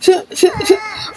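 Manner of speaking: put-on high whimpering sob, a girl hurt; child's crying. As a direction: cry out to mummy after the prick. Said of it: "तुम क्यों चिल्ला रही हो". (रोती हुई बच्ची)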